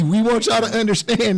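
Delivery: usual speaking voice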